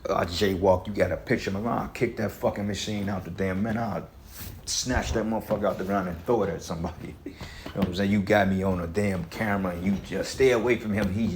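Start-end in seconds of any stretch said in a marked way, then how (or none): none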